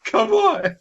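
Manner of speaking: very high, squeaky begging voice; mock pleading squeak. Begging a friend to double the screen in a squeaky mock plea. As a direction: whine it like a begging kid.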